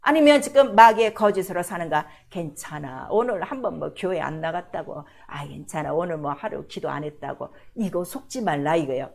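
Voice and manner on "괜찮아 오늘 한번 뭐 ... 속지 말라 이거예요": a tempter soothing you into laziness; coaxing devil's voice. Acted. Voicing the devil's lies.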